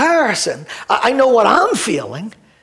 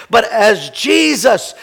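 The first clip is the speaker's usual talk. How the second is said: ordinary talk, nothing marked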